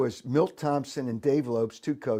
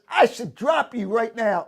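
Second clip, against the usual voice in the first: higher, louder threat in another voice; retold threat from an angry manager; mock threatening manager; 9 semitones higher, 5 dB louder than usual. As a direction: threaten him like the manager did. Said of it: "He says".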